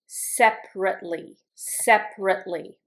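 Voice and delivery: "separately" said SEP-rit-lee; three clear beats in each "separately"; A left out. Shown as a demonstration. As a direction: drop the middle A from "separately". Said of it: "I don't pronounce the A".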